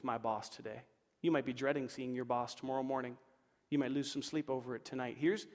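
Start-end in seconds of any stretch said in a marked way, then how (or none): none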